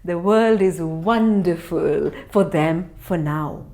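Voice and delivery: ordinary delivery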